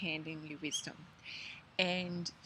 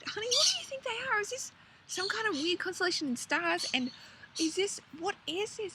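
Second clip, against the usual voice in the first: much higher, fast amazed asking; wondering question; 13 semitones higher than usual. Retold or imitated. Asking her partner about the sky, amazed. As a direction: puzzle over it aloud in awe. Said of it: "And I said".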